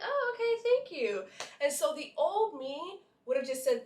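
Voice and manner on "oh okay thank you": high-pitched polite thanks, dropping at the end; acted polite reply. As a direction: re-enact a polite, high little thank-you. Acting her polite reply to stranger.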